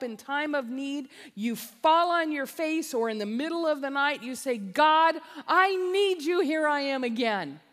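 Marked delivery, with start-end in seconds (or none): 4.6-7.6 loud, high plea to God; desperate prayer. Acted. Believer calling to God at night, loud desperate plea. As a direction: cry out like a sinner on his face.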